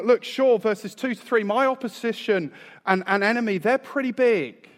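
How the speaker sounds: high, loud, rising boast about the enemies; excited David. Acted. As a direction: voice David boasting, brimming with energy. Preacher voices David's boast.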